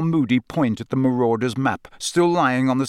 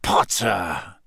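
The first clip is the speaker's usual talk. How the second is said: Potter snarled out lower and slower, full voice; a low drawn-out snarl on Potter, no whisper now; 6 semitones lower than usual. A narrator's reading. Snape turning on Harry's hiding place, low snarl. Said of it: "Snape snarled".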